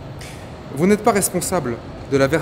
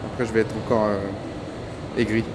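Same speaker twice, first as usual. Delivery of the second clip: trailing off; 6 semitones lower, 6 dB quieter than usual. Real, not acted. Stops himself, refuses to finish.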